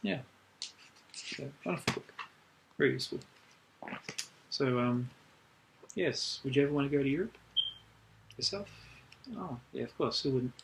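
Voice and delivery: ordinary talk, nothing marked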